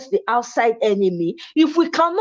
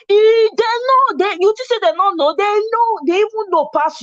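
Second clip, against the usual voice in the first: raised insistence; 10 semitones higher than usual. Real, not acted. Insisting people know the truth.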